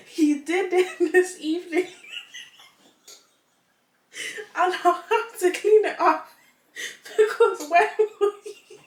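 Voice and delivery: high, loud, breaking into laughter; real laughter; laughing hard. Real, not acted. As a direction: read through fits of laughter. Reading while laughing hard.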